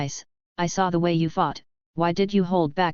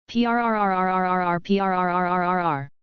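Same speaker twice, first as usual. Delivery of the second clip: phone ringing sound. Acted. Phone ringing on wiretapped call.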